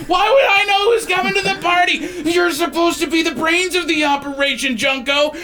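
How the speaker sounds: high, indignant creature squawk; squabbling kobold. Acted. Kobold blaming its partner Junko, high indignant squabbling. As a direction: bicker in a high-pitched strained voice. Kobolds bickering, acted by game master.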